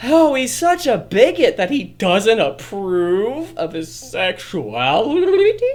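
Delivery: high, slow, loud mock outrage; hammed-up shocked disbelief. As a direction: gasp it like a scandalized gossip.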